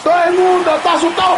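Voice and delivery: excited shout. Real, not acted. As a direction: burst out with the same words. Repeated as shout.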